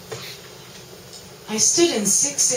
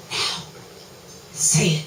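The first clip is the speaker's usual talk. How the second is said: ordinary delivery, nothing marked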